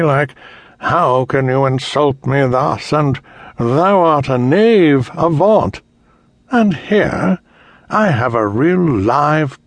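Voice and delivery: gleeful cruel taunting emperor; cold rasping evil emperor. Acted. Emperor mocks the knight.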